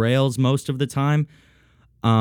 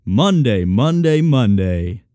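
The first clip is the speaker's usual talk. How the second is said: loud, booming mock radio announcer; hammed-up radio promo shout; 5 dB louder, slower than usual. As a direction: hype it like a monster-truck ad. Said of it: "like, an announcer".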